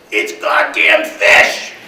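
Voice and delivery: slow, loud mock shout; co-driver yelling as the boat nearly tips; alarmed yell. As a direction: bellow it like you just saw fish at the window. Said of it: "he looks down and he says".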